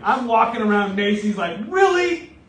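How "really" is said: fuming. Retold or imitated. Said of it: "like, fuming".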